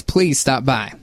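usual speaking voice

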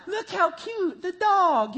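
high, sweet mock child voice; cooing kid. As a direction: coo over the dog like a child. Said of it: "The kid's like".

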